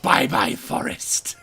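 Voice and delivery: loud shout dropping into a voiceless rasp; gloating farewell. Acted. Player destroying a forest in the way, gleefully menacing. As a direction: wave off the victim with villainous glee.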